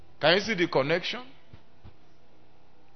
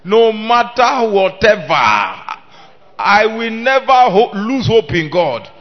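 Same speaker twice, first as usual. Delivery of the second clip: loud, ringing declaration; preacher leading the congregation in a loud vow; full-force preaching; 5 semitones higher, 10 dB louder than usual. Acted. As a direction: proclaim it for the church to repeat.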